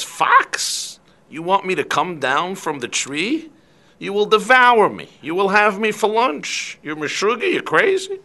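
high, indignant refusal rising to a squeak; alarmed bird. Acted. Bird refusing the hungry fox, high and indignant. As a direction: scoff at the fox's obvious trick.

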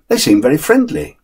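usual speaking voice

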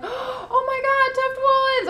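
squealing with delight, stretching the words; re-enacted excitement. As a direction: replay your overjoyed outburst at full pitch. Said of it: "I was so excited".

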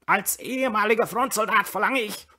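high, loud, strained croak. A narrator's reading. Brüller demands credit as an ex-soldier, croaking. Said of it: "krächzte der Brüller".

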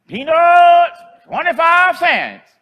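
high, loud drawn-out peddler's cry; street vendor call. Retold or imitated. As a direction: call it out like an old lady selling peanuts down the street. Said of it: "she called out".

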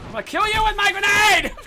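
high, loud gamer threat; full-voiced high-pitched battle yell; furious shout. Real, not acted. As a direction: scream it at your opponent. Threatening opponent in game.

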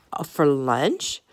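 drawn-out question with a big rise. A narrator's reading. Donald hears friends are hunting for lunch, questioning.

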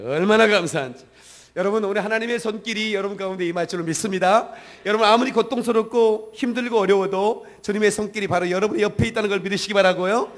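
loud fervent preaching, building to a call; preaching at full force. Acted. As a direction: preach the blessing with full fervor and volume. Full-force sermon climax.

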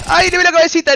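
high, loud burst of alarm; excited yelp. Real, not acted. Streamer glimpsing a head mid-round, excited yelp. Streamer reacts to spotting an enemy.

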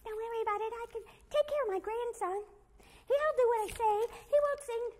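put-on high old grandmother voice; high, reassuring grandmother voice; Grandmother Mouse. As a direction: speak high like a confident granny. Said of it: "Grandmother Mouse".

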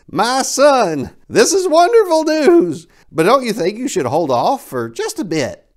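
high, bright-eyed fatherly gush; delighted father voice. Acted. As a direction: gush like a proud dad.

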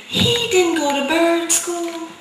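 usual speaking voice

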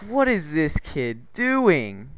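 very slow, stretched-out bewildered question; drawn-out disbelief. Retold or imitated. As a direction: stretch each word in acted disbelief. Retold disbelief at classmate's behaviour.

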